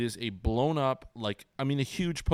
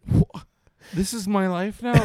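louder, higher incredulous outburst; exasperated disbelief; 10 semitones higher, 6 dB louder than usual. Retold or imitated. Dad reacting to his son's pun, loud mock disbelief. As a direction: throw up your hands in disbelief. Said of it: "And I'm like".

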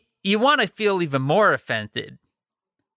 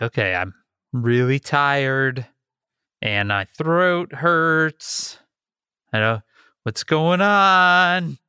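slow, dragged-out recollection of tiredness; retold weary worry; slower than usual. Host recalling a tired weekend with sore throat, slow weary worry. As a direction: stretch it out as if worn down. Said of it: "I was like".

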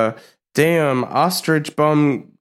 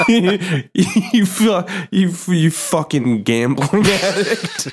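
laughing insult; 6 semitones higher, slower than usual. Real, not acted.

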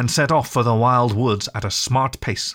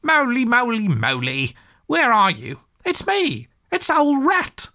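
high-pitched searching call through the woods; cheerful calling out; 12 semitones higher than usual. A narrator's reading. Rat searches the wood for Mole, calling out cheerfully. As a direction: call out cheerily to a lost friend. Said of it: "all the time calling out cheerfully".

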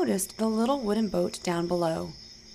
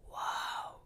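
breathless whispered whoa; awed whoa; 12 dB quieter than usual. Acted. Peter on the water, whispering in awe. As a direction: let the wonder slip out under your breath. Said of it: "thought Peter".

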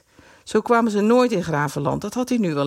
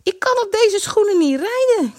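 high, louder plaintive whine; complaining girl; 5 dB louder than usual.

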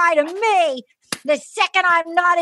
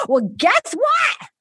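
mock triumphant shout; 6 semitones higher, much slower than usual. Woman's triumphant declaration quoted.

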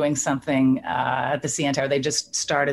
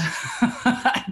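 airy unvoiced giggle, pitched up; soft breathy laugh; 8 semitones higher than usual. Real, not acted. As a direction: let a light laugh escape. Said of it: "I just laughing".

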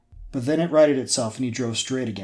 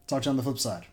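ordinary delivery

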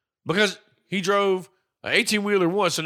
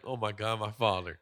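mock awe; 8 semitones lower, 8 dB quieter than usual. Kid reading the letter.

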